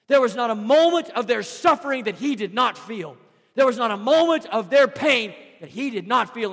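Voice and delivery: loud, high, driving sermon cadence; full-force preaching. Acted. Preacher to his church, rising fervent preaching. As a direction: preach it hard to the congregation.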